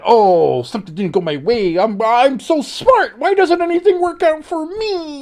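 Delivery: high, whiny mock of a sulking player; mocking the angry player's tantrum in a whiny voice. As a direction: whine it like a sore loser throwing a fit. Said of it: "so mad".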